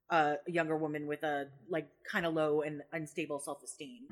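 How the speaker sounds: ordinary talk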